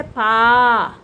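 పా with a long, held aa; drawn-out teaching model of పా; long vowel. Shown as a demonstration. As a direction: stretch out the vowel of పా. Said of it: "పాకి దీర్ఘం ఇస్తే".

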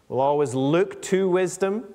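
ordinary talk

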